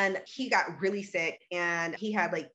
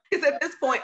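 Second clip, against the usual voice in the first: a high, loud laugh spilling into words; real laughter breaking into the line; bursting laugh; 12 semitones higher, 6 dB louder than usual. Real, not acted. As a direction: laugh out loud, then talk through it. Laughs at own story.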